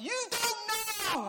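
very high, loud, drawn-out yell; imitated tantrum cry, stretched out; child's panicked scream. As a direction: shriek it like a distraught little boy. Son's panicked retort to dad.

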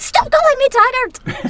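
high, loud, slow Scottish protest; Scottish shout. Retold or imitated. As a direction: blurt it out Scottish-style at the start of a take. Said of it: "start every take with".

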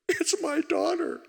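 slow, high, tearful voice of the grieving man; imitating tearful man. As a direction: act out his broken-up answer, half laughing. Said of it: "and he starts crying".

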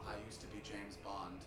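low, quiet attempt at a Scottish accent; feed the line softly with a light Scottish accent; Sean Connery. Shown as a demonstration. Comedian prompting a volunteer's line, soft half-hearted Scottish accent. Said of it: "I'm doing a Scottish accent too".

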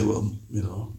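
low, soft, offhand shrug of a reply; a bored brush-off, low and soft; indifferent mumble. Retold or imitated. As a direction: mumble it like you barely care. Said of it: "honestly just say".

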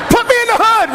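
repeating the joke for the crowd, high and loud; punchline. Real, not acted. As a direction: belt the joke line out.

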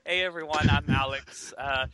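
ordinary talk, nothing marked

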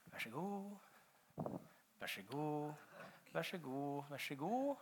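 very soft, as if off to one side; murmured polite offering, repeated; quiet handing out. Acted. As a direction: softly pass the food along the row. Miming disciples handing out food.

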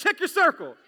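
high, loud and firm order; forceful command. Shown as a demonstration. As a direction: snap it at them like a strict parent. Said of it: "do it one more time".

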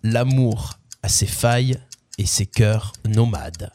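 deliberate reading, voice sinking at the end; lyric recitation. Acted.

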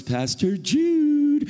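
high, loud, drawn-out reproach; mock scolding wife. Wife in the car catching the pastor out, mock scandalized cry. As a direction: stretch the name in shocked rebuke. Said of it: "She goes".

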